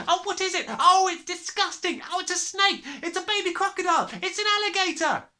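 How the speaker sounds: high, loud squeals of disgust; children shouting. A narrator's reading. Children squealing at the newt, high and loud. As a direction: shriek like a frightened class.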